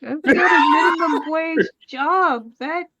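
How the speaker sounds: mock whiny wail. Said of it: "She's like".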